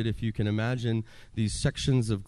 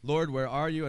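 panicked thoughts; 6 semitones higher than usual. Retold or imitated. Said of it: "So now I'm thinking".

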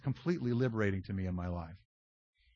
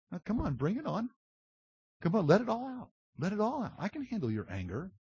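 lightly voiced God, higher pitched and coaxing; the Lord urging; 5 semitones higher than usual. The Lord telling a believer to let anger out, lightly voiced encouragement. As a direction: lightly play God inviting someone to vent. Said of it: "the Lord's like".

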